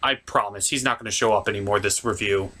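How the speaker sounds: ordinary talk, nothing marked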